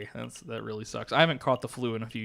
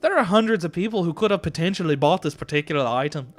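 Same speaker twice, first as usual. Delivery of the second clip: loud, higher shopkeeper with a Scottish-like lilt; botched Scottish accent; 5 semitones higher, 7 dB louder than usual. Acted. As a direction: protest loudly in a wandering Scottish accent. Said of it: "Now I've switched to Scottish".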